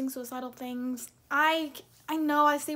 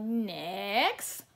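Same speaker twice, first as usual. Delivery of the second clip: SpongeBob clerk voice; 5 semitones lower than usual. Retold or imitated. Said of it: "he's like".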